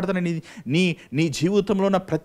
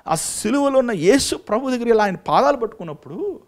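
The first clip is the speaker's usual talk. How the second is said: fervent preaching; 7 semitones higher, 5 dB louder than usual. Acted. Preacher proclaims the cross's power to his flock, fervent. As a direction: preach each line like a drumbeat.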